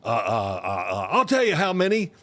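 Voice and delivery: tongue-tied stalling, voice climbing; flustered stammer. Retold or imitated. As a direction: quarterback caught off guard, stammering. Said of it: "I couldn't even get a word out".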